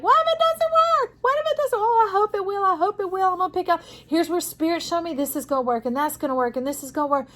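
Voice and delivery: high, loud, fretful inner worrying voice; anxious inner voice. Retold or imitated. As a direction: voice the panicking brain, high and frantic. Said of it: "it's your brain screaming out like".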